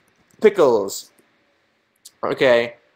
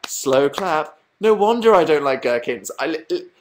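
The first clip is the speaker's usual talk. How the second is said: ordinary talk, nothing marked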